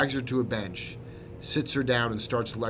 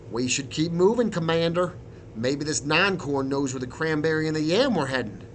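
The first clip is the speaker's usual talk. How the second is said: slightly dismissive, higher-pitched sergeant; 5 semitones higher, 4 dB louder than usual. A narrator's reading. Sergeant presses his commander to keep moving, mildly dismissive. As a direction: brush it off and urge them onward. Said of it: "The colonel grunts dismissively, then says to me".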